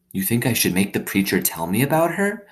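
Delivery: put-on a girl confiding in her new dog; young girl Opal.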